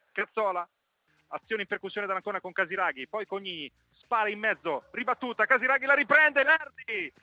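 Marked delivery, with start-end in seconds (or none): 5.5-7.1 excited. Real, not acted. Shot saved.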